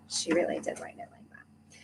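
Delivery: a hushed, secret aside; whispered to herself, not to the audience. Real, not acted. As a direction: whisper it under your breath. Repeats aside under her breath.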